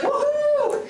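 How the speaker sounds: mock crowd woohoo, very high; crowd cheer. Speaker imitating the cheering rally crowd, high mock woohoo. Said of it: "Everyone's like".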